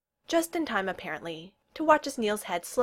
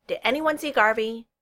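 quick, raised-pitch question; asked quickly; 4 semitones higher, 6 dB louder than usual. A narrator's reading. Neil checks on Garvey's whereabouts, quickly. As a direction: jump in hurriedly, pitched up. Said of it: "Neil asked quickly".

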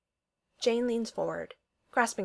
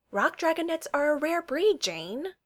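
high, playful feigned innocence; wide-eyed innocence; 8 semitones higher than usual. Acted. Darcy dodging her boss's question, wide-eyed innocence. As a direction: play innocent, lilting upward. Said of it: "most wide-eyed, innocent look".

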